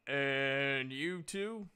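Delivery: slow, drawn-out question; Duke asking. Acted. Duke the guard turning to Xyphos and Sullen: slow, drawn-out question. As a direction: draw out the question slowly.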